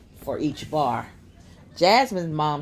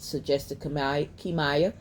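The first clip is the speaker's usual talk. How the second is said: plain reading voice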